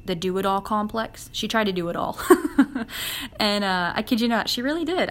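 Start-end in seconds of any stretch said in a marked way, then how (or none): 2.2-3.3 real bursting laugh; higher, louder chuckle. Real, not acted. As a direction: laugh out loud at the remark. Laughs after "do it all.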